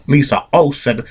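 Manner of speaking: usual speaking voice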